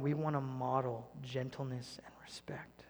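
whispered softly; hushed. Real, not acted. Pastor to his church, whispered. Intimate point in sermon.

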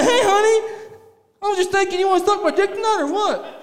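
high, loud, laughing sweet-talk; husband sweet-talking his wife, high and laughing. Retold or imitated. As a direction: ask it in a high cutesy voice through laughs. Mock sweet ask to wife.

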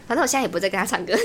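ordinary delivery

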